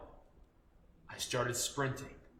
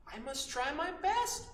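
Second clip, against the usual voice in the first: higher, softer brave resolve; a hint of a young rooster; 10 semitones higher, 4 dB quieter than usual. Young rooster on the barn top, higher, softly determined. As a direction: steel yourself quietly. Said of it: "He said".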